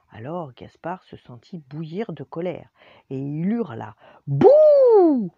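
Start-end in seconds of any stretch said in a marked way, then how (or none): 4.3-5.3 bouh hurlé très fort et très aigu; bouh méchant hurlé. Acted. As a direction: hurle de colère pour terrifier. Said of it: "si fort et si méchamment". (Gaspard furieux hurle bouh au professeur)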